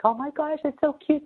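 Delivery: squealing high with delight, imitating her own thrill; giddy excitement. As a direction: gush like you just saw something adorable. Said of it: "that gives me butterflies".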